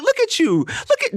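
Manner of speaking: mock the fool from the crowd; high mocking jeer; mocking onlookers. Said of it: "they would be like".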